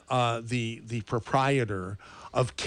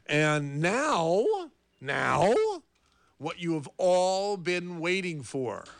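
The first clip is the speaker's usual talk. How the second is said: announcer build-up; 3 semitones higher, much slower than usual. Acted. Dramatic radio build-up before countdown.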